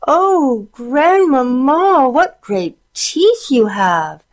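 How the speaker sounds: high slow fearful squeal. A narrator's reading. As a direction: squeal in fright. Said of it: "she squealed".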